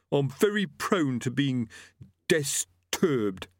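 high-pitched, put-on aggrieved voice; defensive butcher. Killer excusing himself to Death, defensively. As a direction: plead it high, labouring the last word. Gripper's held voice.

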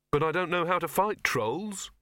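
mild high-pitched wail. A narrator's reading. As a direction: plead helplessly, voice lifted high. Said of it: "Victor wailed".

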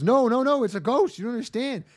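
high, loud mock panic; panicked plea. Frumpy Girl pulls her friend back from the ghost, high and panicked. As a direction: cry out in alarm. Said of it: "Frumpy Girl goes".

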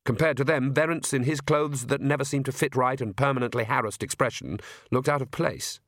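ordinary delivery, nothing marked

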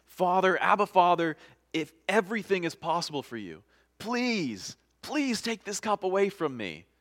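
high, earnest cry to a father; plead, opening loud, pitch held high; desperate pleading. A narrator's reading. Acts Jesus' repeated desperate prayer.

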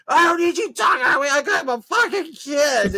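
high, strained screaming imitation; very high, loud retort; yelling back. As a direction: scream back in a mocked frantic voice. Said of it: "they start yelling at each other".

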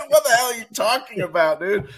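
high, loud, incredulous; can't believe what he's hearing; baffled. Retold or imitated. Baffled reply to drunk stranger.

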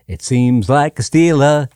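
usual speaking voice

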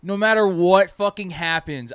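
normal talking voice

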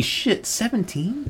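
shocked he was seventeen. Real, not acted. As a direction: gasp it out in disbelief. Shocked at the age.

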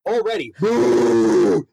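slow drawn-out booing shout; mock boo. Jeering crowd booing the performer, drawn out.

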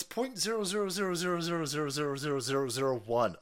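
fast, run-on string of digits; quick repetitive drumroll of numbers; rapid-fire zeros. Real, not acted. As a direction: rattle the zeros off without a gap. Rattles off zeros to belittle figure.

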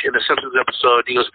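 normal talking voice

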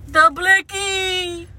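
slow, drawn-out ad announcer. Acted. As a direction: stretch it like a hype ad. Mock commercial break announcement.

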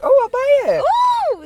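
high, slow, loud mock delight; a squealing, drawn-out fake thrill; mock shopper voice. Friend teasing about bargain-bin DVDs, high mock squeal. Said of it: "you're like".

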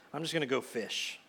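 resigned. Acted. Peter giving up, goes fishing.

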